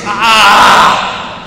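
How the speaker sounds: drawn-out "Ah!" shouted out; storyteller's scream. A narrator's reading. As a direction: cry out like a boy who fell. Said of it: "and screams".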